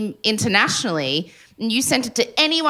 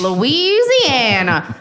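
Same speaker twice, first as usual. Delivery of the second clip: slow, stretched Southern drawl; Southern accent; 3 semitones higher, 3 dB louder, much slower than usual. Acted. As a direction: draw the place name out in a mock Southern accent. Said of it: "we do the accent".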